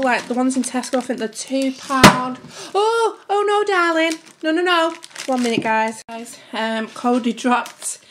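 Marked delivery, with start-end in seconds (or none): 2.7-4.9 alarmed calling out. Real, not acted. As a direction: call out in alarm, voice jumping up. Calls out to child who dropped sauce.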